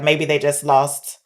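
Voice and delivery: ordinary delivery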